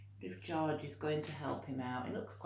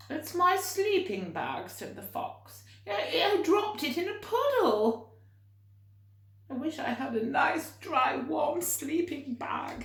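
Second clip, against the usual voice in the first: high, loud, tearful fox voice; crying fox; 10 semitones higher, 5 dB louder than usual. Acted. Fox crying to George about its wet sleeping bag. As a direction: wail it like a sad little fox. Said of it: "a fox who was crying".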